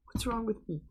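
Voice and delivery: breaking into helpless laughter; laughing at oneself. Real, not acted. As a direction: squeeze the words out through a laugh. Laughing at own silly remark.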